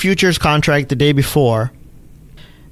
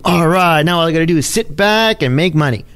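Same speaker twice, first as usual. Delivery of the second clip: ordinary talk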